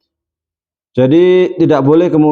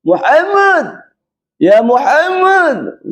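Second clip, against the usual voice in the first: high, drawn-out call of the name; imitating a rude shout to the Prophet; 11 semitones higher, much slower than usual. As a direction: yell the name across a crowd. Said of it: "Manggil Nabi kok teriak-teriak".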